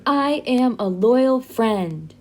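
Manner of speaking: slow, deliberate chant for the class to repeat; slow model phrase. Shown as a demonstration. Teacher leading students to repeat the phrase slowly. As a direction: say each word slowly and clearly. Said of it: "So everyone say".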